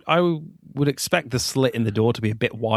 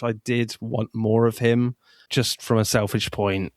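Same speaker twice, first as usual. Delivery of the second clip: ordinary delivery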